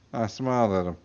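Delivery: plain reading voice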